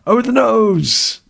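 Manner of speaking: very high, slow exclamation; squeaky exclamation. Pretend. Vlogger squeals a game line in a very high voice.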